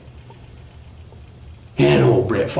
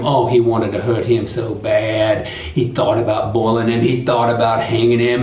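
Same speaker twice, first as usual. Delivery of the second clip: ordinary delivery, nothing marked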